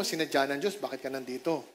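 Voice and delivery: softly, as if to the person beside you; hushed prompt to the person next to you; quiet aside to neighbour. Shown as a demonstration. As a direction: lean in and say it gently. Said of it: "Sabi mo sa katabi mo".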